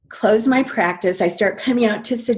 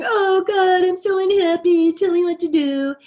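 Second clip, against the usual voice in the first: much higher, whiny mock complaint to God; high nasal-free mock whimper, pleading; whining plea; 10 semitones higher than usual. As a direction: whine it like a sulky child begging. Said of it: "I was whining".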